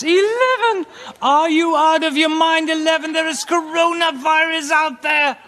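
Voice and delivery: high, loud incredulous cop; outraged police. Acted. Comedian as a cop scolding the tiny protest. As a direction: bark it as a cop who can't believe the number. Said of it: "The police says".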